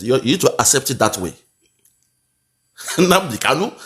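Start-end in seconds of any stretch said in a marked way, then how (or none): 3.0-3.7 higher imitation of a Yoruba accent; mock Yoruba accent. Commentator mocking how his opponent says the name, Yoruba accent. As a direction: Nnamdi Kanu mimicked in a Yoruba accent, lifting at the end. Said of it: "that Yoruba accent be that".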